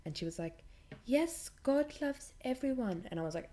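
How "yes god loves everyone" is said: high, slow, sugary mock preacher lady; hammed-up churchy lady voice; mock sweet preacher. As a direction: parody her syrupy certainty, pitched way up. Mimicking preacher lady's sweet reply.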